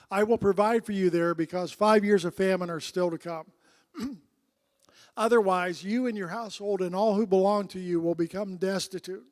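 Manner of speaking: solemn scripture read to the congregation. Acted. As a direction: read the passage out with weight. Reading scripture aloud.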